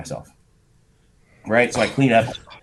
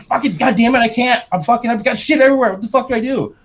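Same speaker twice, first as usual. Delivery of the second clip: panicked yell; 11 semitones higher than usual. Retold or imitated. Trapped in the airport stall, he yells back to his wife in panic. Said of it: "I said".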